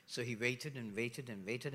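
usual speaking voice

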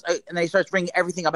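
normal talking voice

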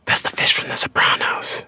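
secretive stage whisper; urgent whisper. Acted. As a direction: whisper it aside so he can't hear. Secret aside away from salesman.

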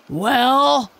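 loud, demanding gorilla bark; a giant beast booming down; huge gorilla voice. A narrator's reading. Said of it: "said a huge voice".

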